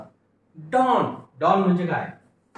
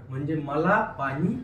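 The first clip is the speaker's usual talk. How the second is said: ordinary talk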